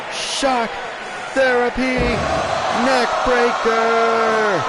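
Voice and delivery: excited ringside call of a move; hyped move call. Acted. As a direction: belt out each move name. Calling wrestling moves.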